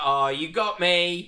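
drawn-out, loud mock surrender; mock defeat. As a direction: concede like a hammy cartoon loser. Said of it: "the face that the referee pulls".

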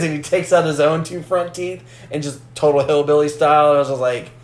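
ordinary delivery

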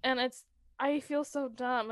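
normal talking voice

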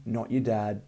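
ordinary talk

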